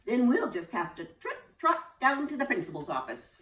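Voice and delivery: high, loud teacher voice wagging a finger; a fussy teacher telling off a pupil; scolding teacher. Acted. Teacher Missus Bell scolds Lulu, high and fussy.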